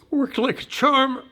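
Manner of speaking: proud reveal. Retold or imitated. Son surprising his mother, a proud slow reveal. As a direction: announce it to mom with pride.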